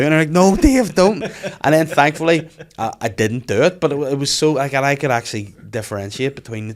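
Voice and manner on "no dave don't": high, loud acted warning; alarmed protest. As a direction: cry out to stop yourself. Acted inner voice stopping him.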